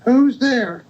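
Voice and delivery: very high put-on granny voice; wolf posing as grandmother. Wolf in grandmother's bed answering the knock, very high imitation. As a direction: mimic grandmother in a high-pitched call. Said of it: "and the wolf said".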